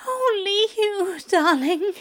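high, rapturous lover's moan. A narrator's reading. Aziraphale to lover, moaning devotion. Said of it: "Aziraphale is moaning".

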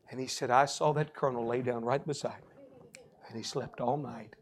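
low, soft storytelling; hushed testimony. Retold or imitated. Preacher telling how the colonel finally slept, hushed. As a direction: let the story settle, low and reverent.

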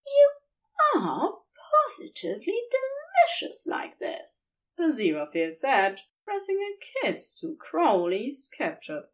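adoring husband teasing back; fond angel. A narrator's reading. Aziraphale voiced in narration.

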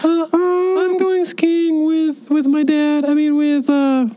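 high, slow put-on grown-up voice; a teen badly faking his father; fake dad voice. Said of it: "Your voice almost sounded like an adult's voice".

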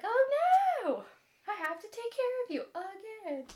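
put-on high-pitched groaning complaint; mock exasperated whine. Captor must nurse hurt princess, mock exasperated. Retold captor faces caring for her.

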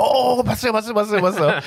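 high, gushing fan voice repeating 봤어요; 흥분한 팬 흉내; excited fans. Retold or imitated. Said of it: "they'd be like".

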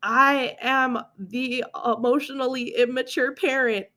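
mock horror at "Oh no"; a light, self-mocking gasp of discovery; dismayed realization. Host retelling her reading of the book, mild mock dismay. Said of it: "I read it and I was like".

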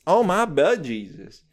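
ordinary delivery

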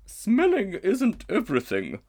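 very high, loud, slow musing elephant; a grand, ponderous lady elephant; she-elephant. A narrator's reading. She-elephant pondering the strange creature.

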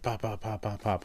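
quiet pop pop pop, mouth gunshots; muttered gunfire sound effect; soft gunfire noises. Retold or imitated. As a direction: mimic the shots under your breath. Imitating gunshots softly.

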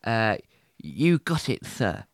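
normal talking voice

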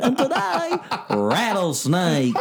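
put-on high boyish voice, laughing; [name] impression. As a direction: drawl it out like a high-pitched kid. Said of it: "I like your Mason impression".

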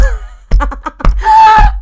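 very high, loud burst of laughter; shrieking laugh. Real, not acted.

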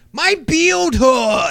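put-on high, loud, very slow whining kid; slow childish wail about a sore beard; four-year-old with a beard. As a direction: whine like a small bearded child in pain. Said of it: "impression of a four-year-old with a full beard".

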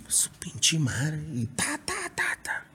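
whispered fed-up cursing under breath; hushed curse. Retold or imitated. Singer retelling muttered frustration on stage.

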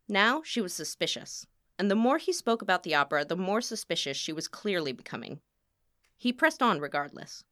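ordinary delivery, nothing marked

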